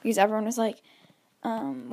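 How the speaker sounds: ordinary talk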